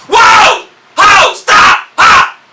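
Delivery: short, very high, full-force yells; screamed warning. Shown as a demonstration. Parent to child chasing a ball before a truck, screaming. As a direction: yell one-syllable jabs of alarm. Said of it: "screams, or yells, or jabs".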